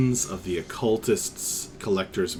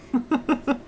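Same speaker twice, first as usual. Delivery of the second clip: short laugh; 14 semitones higher than usual. Real, not acted. Mic mishap, laughing at self.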